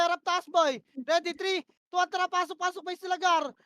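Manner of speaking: loud, high, urgent calls; yelled commands. Real, not acted. Shotcaller screaming push orders mid-fight. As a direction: bark the push at the squad.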